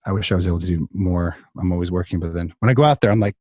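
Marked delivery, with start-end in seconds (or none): none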